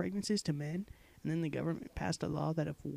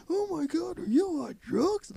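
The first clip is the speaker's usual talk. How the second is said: mock shrieking commenter; 12 semitones higher, 8 dB louder than usual. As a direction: screech like a baby pterodactyl. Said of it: "cries of".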